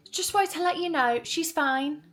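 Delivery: high, light voice imitating a nurse; breezy nurse. Acting the nurse's breezy update.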